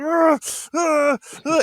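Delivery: high strained growling grunts; frustrated grunt. Retold or imitated. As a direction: grunt like he's about to lose it. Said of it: "He's like".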